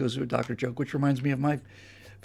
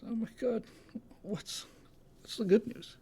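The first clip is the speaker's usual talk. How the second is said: higher, soft tearful voice; mock sobbing; 6 semitones higher, 10 dB quieter than usual. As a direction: act a weeping patient pleading, lightly. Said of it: "Just, just weeping and sobbing".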